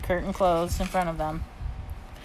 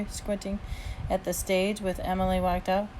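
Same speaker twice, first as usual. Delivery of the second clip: usual speaking voice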